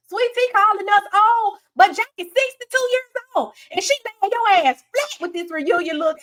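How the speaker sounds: hammed-up gleeful clapback; imitating Heavenly roasting Sweet Tea; Heavenly's read. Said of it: "she was like".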